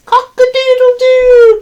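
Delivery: high, loud held crow; rooster crow. A narrator's reading. Rooster crowing at the barn, high loud crow. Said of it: "crowed Rooster".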